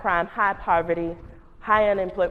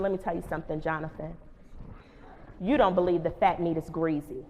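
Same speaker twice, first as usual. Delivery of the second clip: a quiet, menacing warning, much softer; low warning; 5 semitones lower, 10 dB quieter than usual. Retold or imitated. Principal quietly warning the student Jonathan. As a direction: let him know you mean it, hushed.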